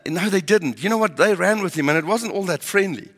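ordinary talk

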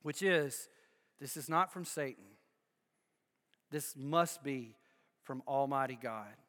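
preacher quietly states the sermon's conclusion; solemn hush. Acted.

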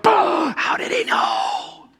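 gasped, drawn-out astonishment; shocked scribe. Acted. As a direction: blurt it out in hushed, slow disbelief. Acting out a startled scribe.

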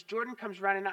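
usual speaking voice

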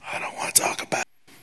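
whisper. Acted.

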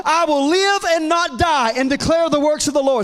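loud, rising declarations of faith; preaching at full force. Acted. Preacher rallying the church, loud fiery declarations. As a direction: shout each promise out like a revival.

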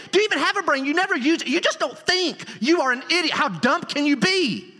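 high, harsh contemptuous berating; hammed-up sneering insult; contemptuous shouting. Shown as a demonstration. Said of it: "It goes like this".

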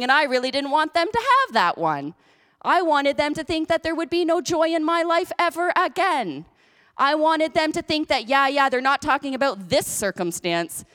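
high-pitched, hammed-up devil's complaint; whining enemy. Preacher voicing the enemy upset at their joy, high mock complaint. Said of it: "says he, the liar".

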